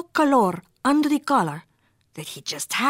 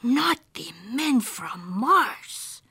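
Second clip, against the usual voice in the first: slow, half-whispered dread; 3 semitones higher, much slower than usual. A narrator's reading. Skipito hearing of men from Mars, dreading. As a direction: stretch each word in hushed alarm.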